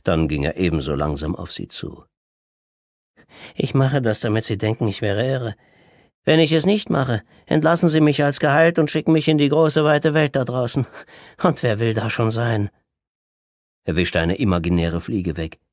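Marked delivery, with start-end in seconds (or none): 3.5-12.7 high, loud patient voice, sly and insistent; the madman explaining his trick to his sister; Orest, cunning patient. Acted. Orest's voice in the story. (hohe, schlaue Stimme des Patienten)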